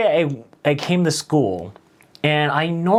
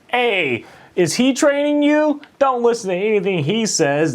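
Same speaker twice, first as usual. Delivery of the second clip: teasing coworker; 9 semitones higher than usual. Retold or imitated. Coworker teasing new hire.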